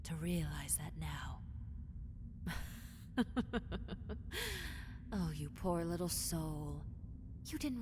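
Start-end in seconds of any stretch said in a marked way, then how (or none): 1.4-5.1 airy hushed cackle; mocking giggle at the hunter; high whispered villain laugh. 5.1-6.8 mock pity.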